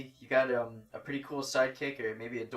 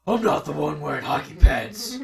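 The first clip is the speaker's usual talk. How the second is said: loud, growled superhero voice; Batman; 2 semitones higher, 9 dB louder than usual. Acted. Quoting a favorite movie scene, loud Batman growl. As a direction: growl it out forcefully. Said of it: "in your Batman voice".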